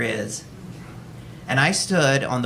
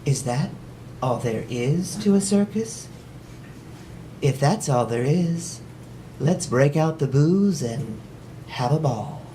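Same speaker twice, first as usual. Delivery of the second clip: put-on mild little girl; 4 dB quieter than usual. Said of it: "what's her little girl pragmatic answer".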